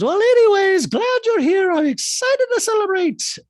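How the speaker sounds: very loud, very high mock-excited impression; hammed-up piercing greeting; Dorit. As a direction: shriek it in falsetto. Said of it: "Dorit goes".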